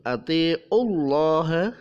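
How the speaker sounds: atiullah with a heavy, full-mouthed Allah; tafkhim Allah. Shown as a demonstration. Teacher reciting atiullah with a heavy Allah. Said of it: "Lafadz Allah dibaca tebal".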